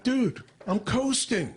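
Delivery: high, drawn-out protest; mock-offended excuse; defensive retort. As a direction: push back slowly in a high voice. Defensive retort to gym manager.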